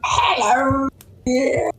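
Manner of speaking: put-on high-pitched old woman greeting. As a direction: creak out a high, slow hello.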